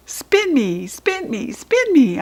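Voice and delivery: high excited chant; hollering. A narrator's reading. Judy B begging Maxine to spin the chair. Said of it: "I hollered".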